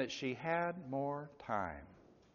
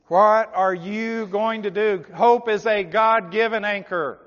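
loud, urgent pulpit appeal; forceful preaching; 7 semitones higher, 10 dB louder than usual. Real, not acted. Preacher appealing to the poor sinner, forceful preaching. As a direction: challenge the listener, then proclaim the answer.

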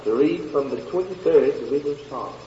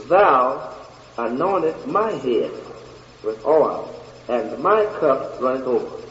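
deliberate, with "Thou" standing alone; slower scripture reading. Shown as a demonstration. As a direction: take the verse slowly, phrase by phrase.

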